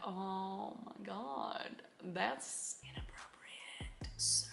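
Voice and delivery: slow, soft shocked murmur; hushed and appalled, very slow; scandalized hush. Real, not acted. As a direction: drop to a drawn-out, scandalized near-whisper. Reacts to a racy suggestion.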